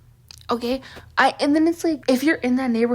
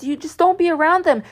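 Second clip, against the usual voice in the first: mocking advice; 7 semitones higher, 4 dB louder than usual. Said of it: "whenever I talk about this".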